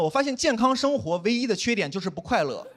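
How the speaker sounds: ordinary delivery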